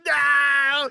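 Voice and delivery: high, hammed-up wailing no; mock crying scream. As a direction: imitate a sobbing scream. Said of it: "He's, like, screaming and crying".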